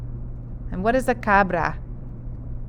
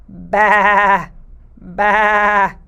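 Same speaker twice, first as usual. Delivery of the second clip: bleating ba ba, a bit louder; sheep baa; 3 dB louder than usual. Shown as a demonstration. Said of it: "¿Qué dice la oveja?".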